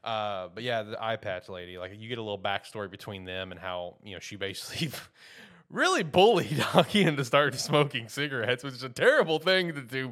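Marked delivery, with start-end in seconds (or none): 5.3-10.1 laughing. Real, not acted. Laughing while telling of bullying.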